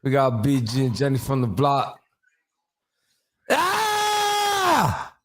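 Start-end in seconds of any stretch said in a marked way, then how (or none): 3.5-5.2 high pained yell of disbelief; howling in shock at the final shot; anguished scream. Real, not acted. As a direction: scream out in sports agony.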